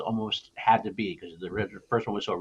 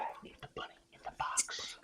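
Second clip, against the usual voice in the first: whispered Nick Cage; 17 dB quieter than usual. Pretend. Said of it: "He's like".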